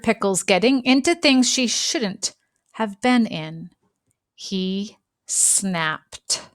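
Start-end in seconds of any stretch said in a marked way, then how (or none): none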